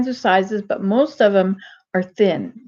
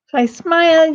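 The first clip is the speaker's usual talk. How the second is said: "smile" said through a broad grin, a bit higher and louder; 6 semitones higher, 4 dB louder than usual. Shown as a demonstration. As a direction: show the smile while saying "If I smile". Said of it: "Or if I smile".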